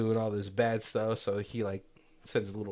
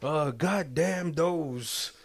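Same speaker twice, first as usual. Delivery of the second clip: drawn-out, very slow sermon line, pitched up; slow preacher voice; 4 semitones higher, much slower than usual. Retold or imitated. Vampire priest covering a slip to churchgoers, very slow preaching. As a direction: stretch every word like a pulpit preacher. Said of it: "And he's like".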